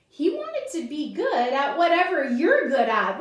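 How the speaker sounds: ordinary delivery, nothing marked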